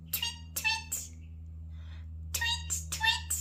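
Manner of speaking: high, soft little chirp; light, high-pitched birdsong; bird tweet. Acted. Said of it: "A bird tweets".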